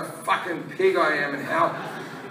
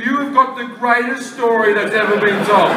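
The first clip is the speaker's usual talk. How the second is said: normal talking voice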